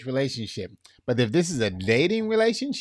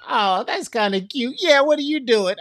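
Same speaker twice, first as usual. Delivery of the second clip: smitten new partner; 11 semitones higher, 3 dB louder than usual. Retold or imitated. Mimics new couple's phone chat.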